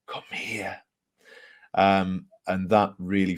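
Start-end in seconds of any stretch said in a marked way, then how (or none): 0.1-0.8 very soft, hushed ghostly summons; hushed, whisper-like imitation of a man's voice; imitated whisper. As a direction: drop right down to imitate a whispering man. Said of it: "like a whispered man's voice".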